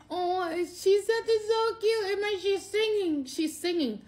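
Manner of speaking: gushing over her niece's cute singing; cooing. Retold or imitated. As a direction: coo it like to a baby. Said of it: "And I'm just like".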